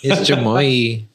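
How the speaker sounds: mock pronunciation. Mocking pronunciation of chamoy.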